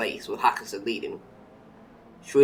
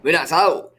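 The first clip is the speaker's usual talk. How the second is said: excited; 6 semitones higher, 9 dB louder than usual. Real, not acted. Driver retires, commentator reacts.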